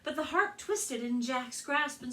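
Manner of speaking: lively read-aloud storytelling voice; story narration. A narrator's reading. Librarian reading Jack and the Beanstalk to children, storytelling.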